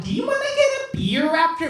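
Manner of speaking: put-on high, ugly American bro accent; aggressive American accent. Bro inviting someone for a beer, aggressive American accent. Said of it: "ugly, uh, aggressive accent".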